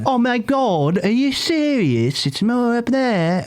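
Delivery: high, slow posh British accent; exaggerated upper-class English voice. Shown as a demonstration. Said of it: "common British accent".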